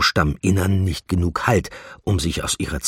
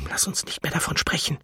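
soft, hushed dismissal; voiceless, secretive murmur; whispered reply; 11 semitones higher, 6 dB quieter, faster than usual. A narrator's reading. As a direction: whisper it to close the subject. Whispered reply while hiding.

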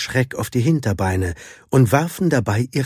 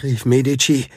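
usual speaking voice

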